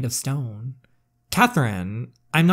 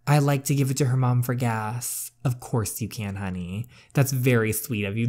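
ordinary talk, nothing marked

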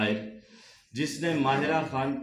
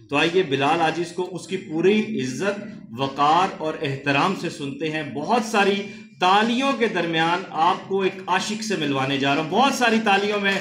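loud, high emcee announcement building up; raised, ringing call to the audience; rousing stage introduction; 7 semitones higher, 6 dB louder than usual. Real, not acted. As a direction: whip up the applause for the next poet. Host rouses applause for poet.

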